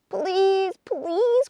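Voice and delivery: very high begging please; children begging Missus Acorn, very high; pleading cry. A narrator's reading. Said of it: "cried the children".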